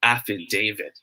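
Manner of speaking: "affidavit" with DA said as "day"; correct long A. Shown as a demonstration. Teacher recaps the right answer, long-A affidavit. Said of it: "it should be pronounced as".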